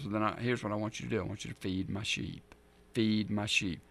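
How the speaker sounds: gentle instruction. Retold or imitated. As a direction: hand him the task quietly. Jesus instructing Peter.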